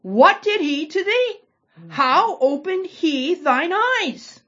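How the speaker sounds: high, slow, incredulous Pharisee voice; exasperated mock question. Teacher voicing the Pharisees to her class, high and exasperated. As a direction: draw it out, as if asked too many times. Pharisees asking again, mocked.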